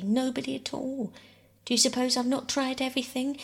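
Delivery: bitter, quick rise into exasperation. A narrator's reading. Mother defending her efforts to Bobby, bitterly. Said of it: "said Mother very bitterly".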